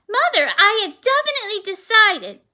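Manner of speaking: loud, high, emphatic declaration; firm announcement. A narrator's reading. Girl declaring her decision to her mother, loud and high. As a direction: announce it, chin up.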